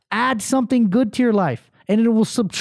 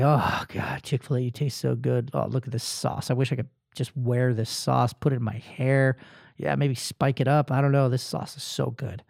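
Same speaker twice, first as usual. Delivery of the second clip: sluggish contented murmur; 7 semitones lower, 7 dB quieter than usual. Retold or imitated. Savoring fast food, sluggish.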